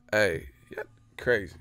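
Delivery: ordinary delivery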